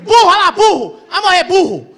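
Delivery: loud laughing yell of burro; shouting burro at the movie; yelling at the screen. Retold or imitated. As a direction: scream at the dumb character. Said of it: "Eu fico".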